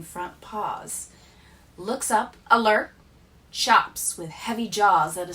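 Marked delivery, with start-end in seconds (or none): none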